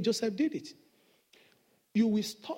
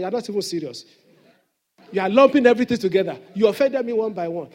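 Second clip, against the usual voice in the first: mock indignant retort; 11 dB louder, faster than usual. Acting his own retort to apologiser.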